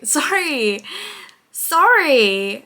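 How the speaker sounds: loud laughing apologies; real laughter through each sorry. Real, not acted. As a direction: giggle through the sorries.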